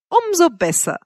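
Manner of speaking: high, delighted cut-in; gleeful interruption. A narrator's reading. Mistress delighted at his dependence, cheerfully. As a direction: jump in pleased, pitch leaping up. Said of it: "unterbrach mich Wanda vergnügt".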